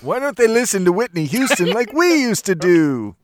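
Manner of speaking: put-on loud, high grumpy old man. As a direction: grumble like an old man yelling at kids. Joining the grumpy old man bit.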